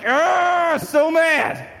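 angry outburst. Retold or imitated. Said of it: "I'm like".

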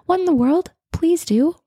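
high, playful mock scolding; mock indignation. Host teasing listeners who haven't left a review, high mock outrage. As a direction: tease them with exaggerated disbelief.

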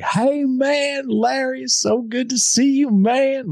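high-pitched, exuberant Nashville greeting; Nashville drawl, full of energy. Retold or imitated. Mentor greets his podcaster friend, high, hammed-up Nashville impression. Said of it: "that's how he talks".

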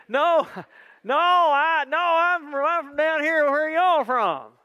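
high, loud, hammed-up Southern drawl; fake Southern accent. [name] denying his northern accent, fake Southern accent. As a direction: protest in an exaggerated Southern twang. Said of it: "I can hear your northern accent".

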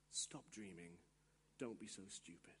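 very low, very soft dismissal; hushed put-down. Acted. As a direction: murmur the discouragement under your breath. Inner lie dismisses the dreamer.

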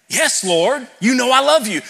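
pained Peter pleading his love; anguished Peter. Acted. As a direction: cry it out like a hurt friend. Peter's anguished answer acted.

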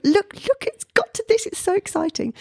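high, eager and breathless; retold excitement. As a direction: urge him to look, giddy.

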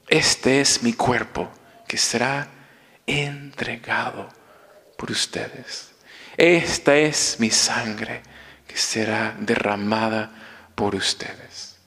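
slow, soft, fading to a whisper; reverent liturgical quote. Acted. Priest reciting the consecration, softening to a whisper. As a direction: speak the altar words in awe.

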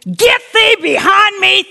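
high, loud rebuke; forceful commanding shout. Acted. Preacher orders a thought form away, shouting commandingly. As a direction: banish it at full voice. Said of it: "I can actually scare people".

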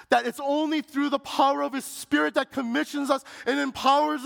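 preaching at full force. Acted. Pastor urging his brothers and sisters, rising preaching. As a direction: build it up like a pulpit climax.

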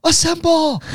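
high, drawn-out mock superhero call; nurse's rallying call. Nurse summoning her team of Avengers, high rallying call. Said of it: "one of the nurse was like".

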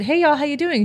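mild Texas twang on a friendly hello; Texas y'all greeting. Retold or imitated. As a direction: greet them like a Texan server. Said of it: "me being from Texas, I'm very much a y'all person".

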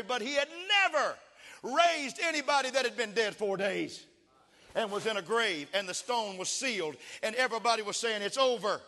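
loud, high-pitched revival preaching building to sustained shouts; full-force preaching. Real, not acted. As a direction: preach it at the top of your voice, building to a hallelujah cry.

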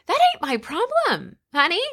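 high, sassy brush-off; sassy retort. Real, not acted. Brushing off the judgy volunteer mom, sassy.

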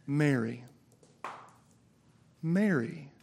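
soft, slow, loving call; quiet tender call; tender calling of a name. Retold or imitated. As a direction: call her gently by name. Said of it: "And then he says".

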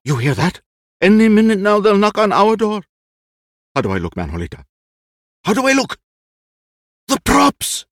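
high, loud, flustered; frantic old actor. Acted. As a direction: panic about the props. Lolo grows frantic.

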